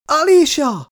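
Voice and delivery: high, loud piercing scream of a name. A narrator's reading. Girl screams for the android who saved them, loud shriek. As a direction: shriek her name into the smoke. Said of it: "sikoltott fel ekkor Jane".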